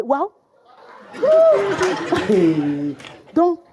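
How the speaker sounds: normal talking voice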